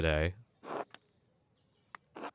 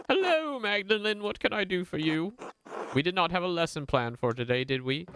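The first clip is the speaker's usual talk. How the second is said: put-on much higher, prim and polite old tradesman; a sharply higher, precise voice for a fussy craftsman; 11 semitones higher than usual. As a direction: play the busy, fastidious master brewer. Voices Baltus character.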